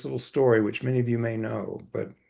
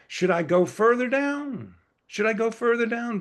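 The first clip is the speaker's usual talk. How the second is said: pleading question; 10 semitones higher than usual. Retold or imitated. Farmer pleads over his hole.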